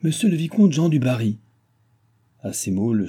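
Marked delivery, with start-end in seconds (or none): 0.0-1.4 annonce solennelle, voix haute; annonce d'huissier. A narrator's reading. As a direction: annoncer un visiteur à toute l'assemblée. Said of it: "l'huissier annonça". (huissier qui proclame un nom)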